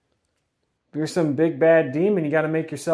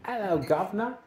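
higher put-on Cockney accent; 5 semitones higher, 6 dB quieter than usual. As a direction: greet him in a Cockney accent. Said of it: "Why are we talking to him in a Cockney accent?".

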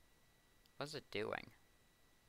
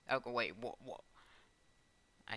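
ordinary delivery

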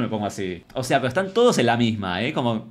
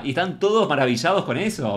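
normal talking voice

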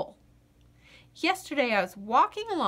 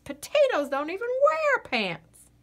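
scoffing lady; 9 semitones higher, 4 dB louder than usual. Acted.

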